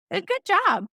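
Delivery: high, playful praise; joking. Retold or imitated. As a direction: tease him lightly with the praise. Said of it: "I said it in a joking way".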